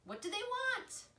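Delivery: high, small gosling voice asking; a scared little goose wants to know; worried gosling. A narrator's reading. Gosling asks his mother.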